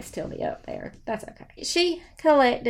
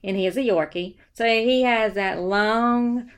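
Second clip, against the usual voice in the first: ordinary talk, nothing marked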